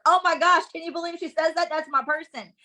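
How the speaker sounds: high, gushing excitement; delighted fan. Retold or imitated. Fan shares the post with a friend, high excited gushing. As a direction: squeal it like a fan who found her person.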